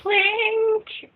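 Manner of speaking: high, playful clink; squeaky high clink. Real, not acted. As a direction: chirp it like glasses touching. Echoing clink, glass raised.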